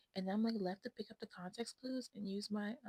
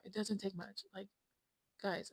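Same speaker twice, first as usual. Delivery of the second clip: ordinary talk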